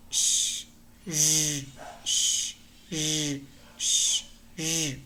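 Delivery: "sh" hissed unvoiced, then "z" buzzed with voice; slow drill contrasting unvoiced "sh" with voiced "z"; sh and z alternated. Shown as a demonstration. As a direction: alternate a breathy "sh" with a voiced buzzing "z". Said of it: "Exactly like sh, but voiced".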